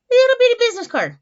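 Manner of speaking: "little bitty business card" high and loud; high, playful emphasis on "Little bitty"; playful squeal. Real, not acted. Cooing over the tiny card.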